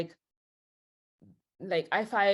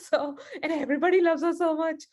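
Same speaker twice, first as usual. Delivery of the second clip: laughing it out, higher and louder and faster; 9 semitones higher, 5 dB louder than usual. Real, not acted. Recalling her infatuation, laughing as she talks. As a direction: giggle through the words.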